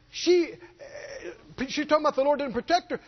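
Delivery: usual speaking voice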